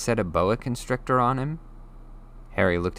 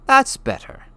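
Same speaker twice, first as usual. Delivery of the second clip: high, pleased grunt; satisfied Hagrid; 9 dB louder than usual. Acted. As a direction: settle back, satisfied. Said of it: "said Hagrid".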